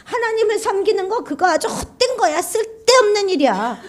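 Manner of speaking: high, scornful grumble; grumbling complaint. Acted. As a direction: sneer at serving God. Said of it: "이렇게 말하고 있다는 거예요".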